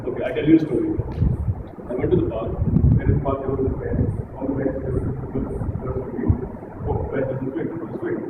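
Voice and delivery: low monotone storytelling; very bland tone. Shown as a demonstration. Coach boring the audience with a pointless story, blandly. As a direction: drone the story out with no inflection. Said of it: "saying that in a very bland tone".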